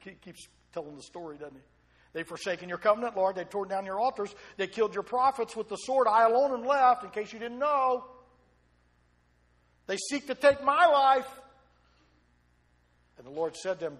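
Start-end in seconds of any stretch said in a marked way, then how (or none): 2.1-11.4 building louder, higher self-pitying complaint; preacher playing a sulking prophet, rising louder; self-pitying Elijah. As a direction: Elijah pleading his case, rising to a wail. Elijah complains again, escalating.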